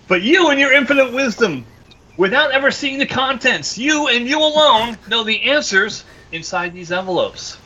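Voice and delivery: high, solemn showman build-up; grand announcer. Acted. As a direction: talk him up like a game show host.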